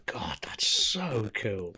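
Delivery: slow breathless whisper of awe; awed whisper. Real, not acted. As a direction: hush it, amazed. Awed reply.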